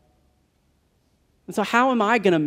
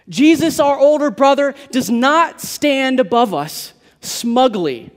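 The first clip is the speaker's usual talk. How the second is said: preaching at full force; 5 semitones higher, 3 dB louder than usual. Real, not acted. Pastor assuring the congregation about Jesus, rising fervour. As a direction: lift the congregation with the answer.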